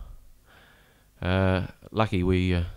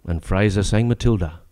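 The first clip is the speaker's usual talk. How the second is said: higher, sing-song reciting voice; comic ballad recitation; 5 semitones higher than usual. Acted. Reciting his comic prawn-war ballad to the audience, sing-song. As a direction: spin the yarn in rhyme for the crowd.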